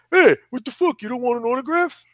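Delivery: high, brash Long Island fighter; Long Island accent. Retold or imitated. Fighter heckling a fan who walks past, Long Island accent. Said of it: "Long Island accent".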